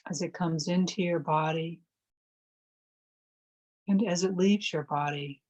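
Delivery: calm, measured phrases with long silences between; guided meditation. Real, not acted. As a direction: guide listeners gently into stillness, leaving space to breathe.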